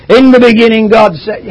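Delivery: mock grand reading of Genesis; booming scripture reading. Shown as a demonstration. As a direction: boom it out like a pulpit reader. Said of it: "reading the Word of God out aloud".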